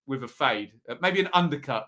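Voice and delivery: ordinary talk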